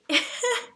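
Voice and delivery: a real burst of high, loud laughter. Real, not acted. Laughing at mishearing Julie's question, loud high laugh. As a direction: crack up at your own mistake.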